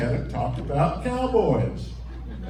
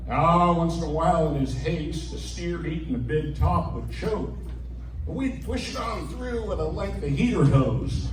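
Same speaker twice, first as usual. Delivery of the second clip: usual speaking voice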